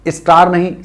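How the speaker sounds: स्टार with an "i" before the s; wrong istar again. Shown as a demonstration. Teacher mimicking the common mistake. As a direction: copy the learner's istar.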